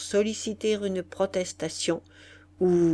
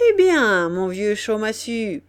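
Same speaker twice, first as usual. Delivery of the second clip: marquis jovial; 3 semitones higher, 7 dB louder than usual. Acted. Le marquis interpelle jovialement Chaudmassu.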